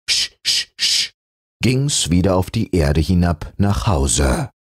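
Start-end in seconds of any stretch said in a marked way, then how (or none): 0.1-1.1 unvoiced sch sch sch like steam; whispered train puff. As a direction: puff like a little engine. Toy train puffing away.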